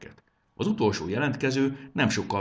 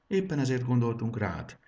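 ordinary delivery, nothing marked

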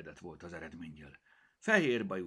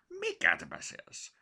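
Picasso cat voice; 4 dB louder, slower than usual. Acted. Cat Picasso answers Mimi.

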